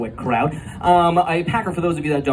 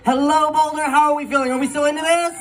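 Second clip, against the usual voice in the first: high, bright call out to the room; hyped crowd greeting; 12 semitones higher than usual. Real, not acted. Comic calling out to the Boulder crowd, hyped. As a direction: rev up the crowd like a host.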